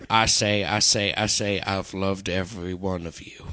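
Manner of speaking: low cartoon rooster on life support; Foghorn Leghorn. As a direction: fade away like a dying cartoon rooster. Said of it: "Foghorn Leghorn on life support".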